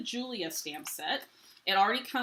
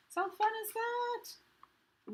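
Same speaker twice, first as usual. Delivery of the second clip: much higher, slow, sing-song delight; delighted gush; much slower than usual. Real, not acted. To viewers about the chipboard buttons, slow high gushing delight. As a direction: stretch every word out in bubbly glee.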